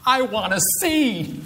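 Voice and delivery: high, drawn-out eager cry; unhesitating eagerness. Acted. Blind man answers Jesus without hesitation, eager. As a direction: burst out with the answer, stretched high. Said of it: "Is there any hesitation in his voice?".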